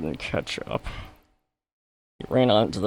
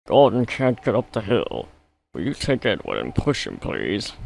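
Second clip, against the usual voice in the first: porter; 7 semitones higher, 4 dB louder than usual. A narrator's reading. Porter asks driver for help.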